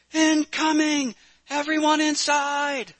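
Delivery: long, high shouted alarm; shouting. A narrator's reading. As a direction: yell to get everyone inside. Nurse herding gawkers under air attack.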